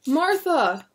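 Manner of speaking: high loud call across a crowd. Acted. William calling to his wife and daughter, loud call. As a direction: call their names over the crowd. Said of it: "William called".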